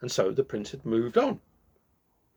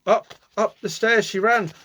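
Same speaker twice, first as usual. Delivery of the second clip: usual speaking voice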